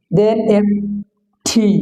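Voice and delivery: normal talking voice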